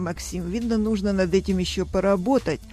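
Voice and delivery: usual speaking voice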